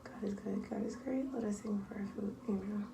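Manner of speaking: soft, hushed mealtime grace; prayer. Real, not acted. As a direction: murmur grace before the meal. Grace said before eating.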